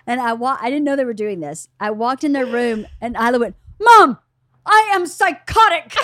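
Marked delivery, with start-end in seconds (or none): 3.8-6.0 put-on high, loud teen voice announcing proudly; daughter's excited claim. Daughter bursting in to tell mom, loud and high. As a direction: squeal it like a proud teenager.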